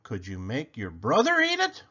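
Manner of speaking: ordinary delivery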